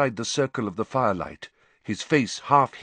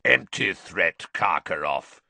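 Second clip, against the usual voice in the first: growl; 2 semitones lower than usual. A narrator's reading. Moody calling Karkaroff's bluff from the door, growling. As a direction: growl it, dropping on the name. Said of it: "growled a voice from near the door".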